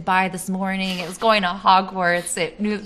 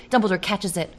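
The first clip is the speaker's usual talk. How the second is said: ordinary delivery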